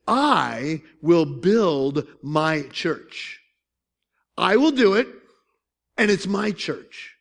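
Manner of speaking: slow, deliberate, weighty words; weighty declaration. Retold or imitated. Preacher quoting Jesus to the disciples, slow weighty declaration. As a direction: lay each word down firmly.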